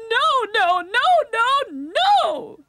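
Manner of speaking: high, loud string of shrieks; screamed. A narrator's reading. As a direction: shriek it over and over in a panic. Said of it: "screamed Willie's aunt".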